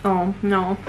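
ordinary talk, nothing marked

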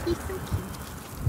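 soft slow whisper. Real, not acted. Gushing over baby.

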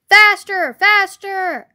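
high, loud urging; shouting. A narrator's reading. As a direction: shout them on, hardest on the first word. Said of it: "and shouting".

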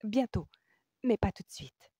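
high, preoccupied musing; talking to herself. A narrator's reading. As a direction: think it aloud, decidedly. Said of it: "qu'elle se parlait à elle-même plutôt qu'à lui".

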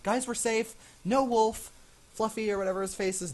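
high, loud announcement; giddy high-pitched relief; relieved and excited. Real, not acted. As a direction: burst out with the good news. Relieved that no wolf appears.

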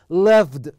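"Loved" said loudly with a clear d at the end; final D pronounced. Shown as a demonstration. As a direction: demonstrate "Loved", closing firmly on d. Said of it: "نطقت هنا دي".

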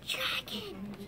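breathy alarmed whisper. Acted. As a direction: hiss the name in fright. Bunny spots dragon, whispers.

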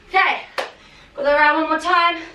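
ordinary delivery

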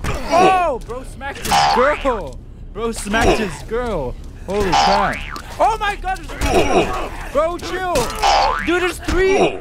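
very high, loud alarmed cries; panicked excitement. Real, not acted. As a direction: shriek as enemies pile in. More enemies appear suddenly.

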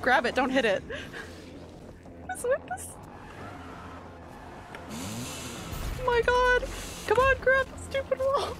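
frantic shrieking. Real, not acted. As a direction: shriek in frustration. Failing to climb wall.

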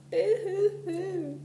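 high, slow drawn-out boo-hoo wail; a child's boo-hoo cry heard from the tree. A narrator's reading. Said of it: "A child was crying".

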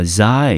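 "xi" said as "zai", a syllable by itself; isolated syllable. Shown as a demonstration. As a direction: sound out "xi" like "zai". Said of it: "xi一个音节".

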